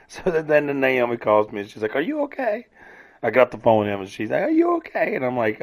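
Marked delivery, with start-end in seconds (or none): 2.0-2.7 high, soft mimicked voice; imitated concerned caller. Naomi phoning to check on him, high-pitched concerned imitation. 4.4-5.2 very high mimicked voice; imitated concerned caller. Naomi on the phone to him, high-pitched concerned imitation.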